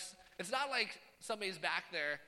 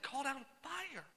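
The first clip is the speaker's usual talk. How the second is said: ordinary delivery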